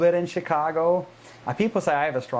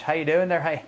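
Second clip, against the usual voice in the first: mock Northern Irish, pitched up; higher, playful take on a northern accent; 3 semitones higher than usual. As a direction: imitate a high-pitched northern Irish greeting. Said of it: "it's the high pitch".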